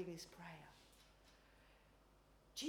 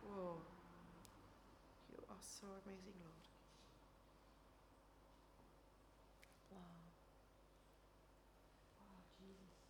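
very soft, awestruck prayer; near-whispered worship; hushed awe; 15 dB quieter than usual. Real, not acted. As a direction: murmur it to God in wonder. Awed private prayer in a pause.

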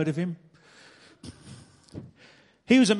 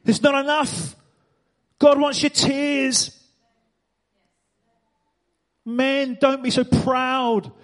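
higher, louder preacher's cry; full-force preaching; 5 semitones higher, 4 dB louder, slower than usual. Acted. Preacher urging the room to cry, loud and high.

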